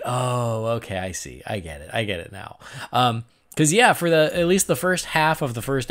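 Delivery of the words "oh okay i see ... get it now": resigned disappointment. Retold or imitated. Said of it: "And then I was like".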